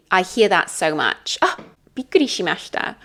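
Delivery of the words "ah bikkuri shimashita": quick, higher-pitched demonstrated surprise. Shown as a demonstration. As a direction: act out a brisk startled exclamation. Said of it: "the emotion of surprise".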